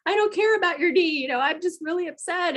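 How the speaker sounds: high, overwrought inner voice; retold upset. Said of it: "sitting here with my emotions".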